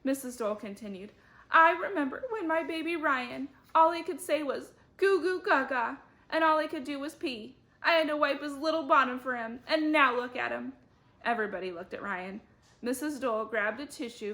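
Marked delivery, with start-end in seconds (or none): 1.5-10.7 weepy mother. Acted. Weepy mother recalls baby days.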